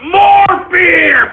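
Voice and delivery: shouted demand. Real, not acted. Shouting for more beer.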